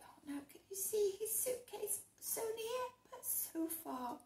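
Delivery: hushed pity; whisper. Real, not acted. Reader whispering to the child about the suitcase. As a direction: whisper it sadly.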